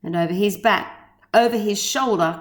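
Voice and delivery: ordinary delivery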